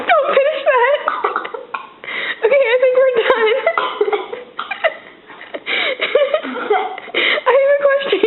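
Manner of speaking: laughing. Real, not acted. Pleading through laughter, disgusted.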